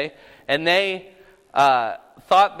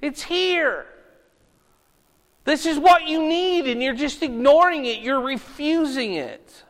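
heartbroken plea; 6 semitones higher than usual. Retold or imitated. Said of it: "His perspective on the lost was".

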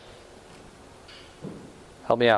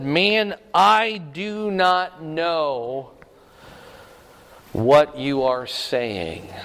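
very slow, drawn-out words; acted denial, slow and deliberate; emphatic denial; much slower than usual. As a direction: deny it one stretched word at a time.

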